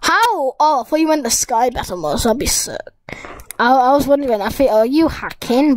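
ordinary talk, nothing marked

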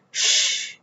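soft unvoiced shush; hushing. Child hushing the reader before showing the kittens. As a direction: hush them before the surprise.

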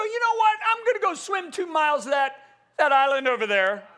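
a hint of a cocky swimmer; bravado swimmer. Passenger boasting he'll swim to the island. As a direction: brag like you'll swim it.

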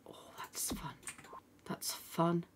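breathy whispered delight; whispering. Real, not acted. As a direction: whisper it to yourself. Delighted aside to herself.

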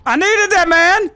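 high, loud thankful shout; relieved cheer. Real, not acted. Triumphant cheer after rescue.

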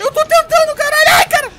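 very high frantic shriek; panicked scream. Real, not acted. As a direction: scream while desperately fleeing. Fleeing monster, friend coaching.

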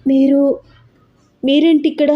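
shocked. A narrator's reading. Young woman shocked to see a visitor, stammering. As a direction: caught off guard, stumbling over words. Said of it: "ఒక్కసారి shock అయిపోయి".

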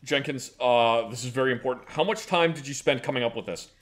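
low, gruff boss voice; goofy muffled sock puppet. Acted. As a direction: play a pompous low-voiced official. Plays the official in the skit.

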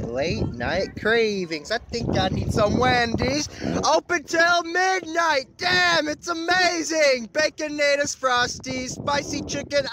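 slow, high hyped ad read; mock commercial voice. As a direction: sell it like a fast-food ad. Mock Wendy's ad.

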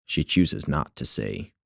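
usual speaking voice

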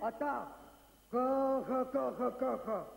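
low, drawn-out director's instructions; director giving orders. Retold or imitated. Director instructing an actor, low commanding voice. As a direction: push it out low and deliberate. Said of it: "הוא אומר".

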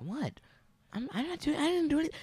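very high squeaky denial; high mock-innocent protest. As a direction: squeak it like a guilty kid. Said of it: "He's like".

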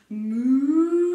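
big loud mooing; mock cow moo. Shown as a demonstration. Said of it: "I can moo like a cow".

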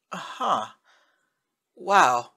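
strained, out of breath; breathless, struggling; labored breathing. A narrator's reading. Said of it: "Tenna's voice sounded labored".

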